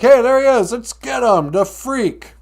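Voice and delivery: loud, high mob yell; angry mob shout. Retold or imitated. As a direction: rile up the crowd to chase him. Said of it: "They're like".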